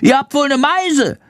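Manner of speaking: high squeaky bat outrage; scolding. A narrator's reading. Bat tells off the attacking swallows, shrilly scolding. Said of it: "keift Schandor".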